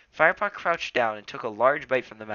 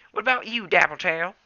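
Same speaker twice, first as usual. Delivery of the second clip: put-on old tom cat voice; 8 semitones higher, 7 dB louder than usual. A narrator's reading. Elder asks another elder.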